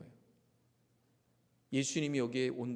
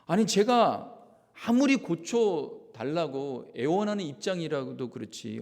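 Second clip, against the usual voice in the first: normal talking voice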